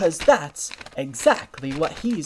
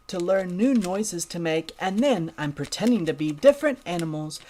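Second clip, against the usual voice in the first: ordinary delivery, nothing marked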